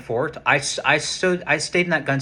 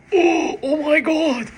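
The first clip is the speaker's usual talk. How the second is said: slow, high, amazed oh my God; stunned gasp; 5 dB louder, slower than usual. Retold or imitated. Spotting a rare pistol in the cabinet, stunned and amazed. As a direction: let it dawn on you, drawn out. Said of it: "My mind had a conniption fit".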